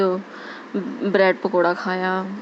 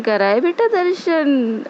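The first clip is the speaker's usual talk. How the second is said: slow, higher-pitched loving question; very affectionate; 5 semitones higher, slower than usual. Retold or imitated. Volunteer aunty asking a devotee about darshan, very affectionate. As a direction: imitate a doting aunty, drawing it out tenderly. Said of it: "बड़े प्यार से बोलती हैं".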